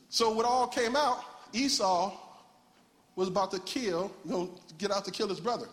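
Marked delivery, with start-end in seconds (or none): none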